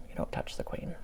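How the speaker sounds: hushed, conspiratorial whisper; whispered aside. Real, not acted. Aside to viewers about royal etiquette, whispered. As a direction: whisper the rule like a secret.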